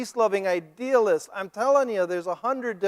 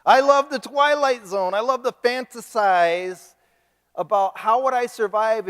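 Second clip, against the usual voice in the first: louder and higher, gushing about what he loves; a prophecy junkie confessing his delight, louder and higher; real enthusiasm; 6 semitones higher, 7 dB louder than usual. Real, not acted. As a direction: let the fan in you burst out. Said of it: "you think I'm nuts".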